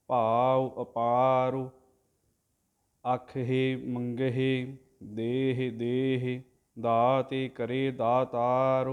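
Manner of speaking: drawn-out devotional chanting, word by word; slow santhiya recitation of scripture; slow Gurbani recitation. Shown as a demonstration. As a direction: chant each syllable slowly and evenly, like a granthi. Teacher chants the Gurbani line.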